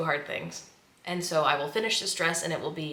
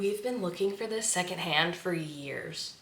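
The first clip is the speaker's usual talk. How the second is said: ordinary delivery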